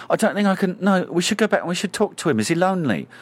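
high, hurried fretting in imitation; worried co-star. Co-star fretting over a masked colleague, mock-anxious. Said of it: "she'll turn around, break character".

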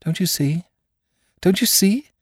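high-pitched, feverish urgency, rising on the repeat; feverish cry. A narrator's reading. Curate pressing his theory on the doctor, feverishly. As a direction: plead to be understood, climbing feverishly. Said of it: "he cried feverishly".

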